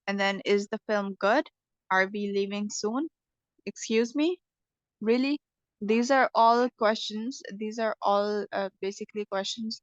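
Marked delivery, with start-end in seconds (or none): 0.4-1.5 "good" swung upward as a yes-no question; rising intonation. Shown as a demonstration. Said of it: "Rising intonations". 1.9-3.1 "soon" lifted as a yes-no question; rising intonation. Shown as a demonstration. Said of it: "Rising intonations". 3.6-5.4 two short questions, "me" and "Really" each lifting; "Really" swung up as a query; rising intonation. Shown as a demonstration. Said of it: "Rising intonations".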